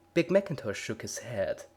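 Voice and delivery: usual speaking voice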